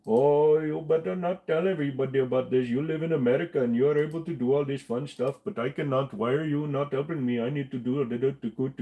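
mock offended scammer whining; angry scammer. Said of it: "he got all angry".

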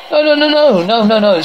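high, rising-panic string of no's; alarmed protest. Acted. Acted desperate cry of no.

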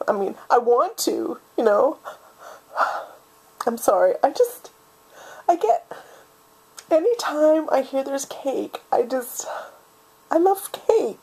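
choked up, voice rising in little bursts; on the verge of tears; getting emotional. Acted. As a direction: overcome with feeling, halting between phrases. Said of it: "I'm sorry, I'm getting emotional".

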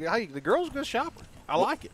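high mock voice of a buddy pleading; lightly mocking friend voice; imitating Cole. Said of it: "It's like".

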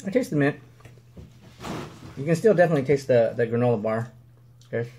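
talking between bites; chewing while talking. Real, not acted. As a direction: mouth partly full. Seen 0: Man in cap holds bar, bites at 3.8s, chews throughout.

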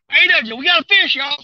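high, loud disbelief and delight; excited. Real, not acted. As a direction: announce a surprise catch to everyone. Announces the fish to viewers.

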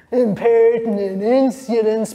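sniffing, rude mock echo; rude mimicry. A narrator's reading. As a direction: mimic her words to mock her. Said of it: "Boots now sniffed rudely".

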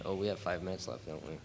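normal talking voice

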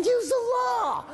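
high, slow, drawn-out shout; frantic shout. Retold or imitated. Frantic sergeant shouting an order across the street. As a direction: yell it across the fire, stretched out.

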